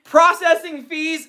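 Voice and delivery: high, slow announcer question on "Processing fees?"; playful infomercial voice; mock infomercial pitch. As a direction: ham up a TV sales host. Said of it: "how do you end an infomercial".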